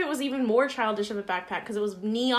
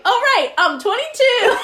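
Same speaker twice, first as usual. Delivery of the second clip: high, loud, laughing realization; laughing correction; 13 semitones higher, 10 dB louder than usual. Retold or imitated. Correcting her age to the clerk, laughing. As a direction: catch your own mistake and crack up.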